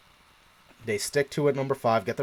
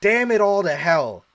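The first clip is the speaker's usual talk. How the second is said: higher, louder hammed-up cursing; mock frustration; 7 semitones higher, 6 dB louder than usual. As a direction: throw your hat down in mock rage. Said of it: "I'm like".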